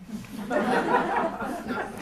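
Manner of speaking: a high, breathy burst of real laughter. Real, not acted. Teacher laughing at his own long list of mind states, a real laugh.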